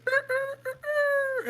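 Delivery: very high imitated rooster crowing. Storyteller imitating a rooster crowing in the night, very high mock crow. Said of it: "you hear a rooster crow".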